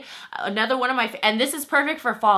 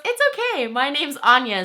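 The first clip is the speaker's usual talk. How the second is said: higher, light quoted stranger's voice; a lightly voiced sweet stranger, pitched up; mimicking the lady; 6 semitones higher, 3 dB louder than usual. The lady forgiving Lizzie over the cart, high mimic.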